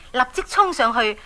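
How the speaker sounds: ordinary delivery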